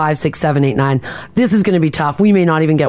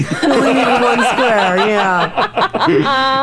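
laughing; 4 semitones higher, much slower than usual. Real, not acted. Repeats the tease laughing.

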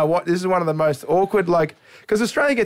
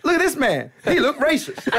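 higher, laughing mock comedian; 10 semitones higher than usual. Comic in New York spots the dad in the front row, mock-calling him out. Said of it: "he goes".

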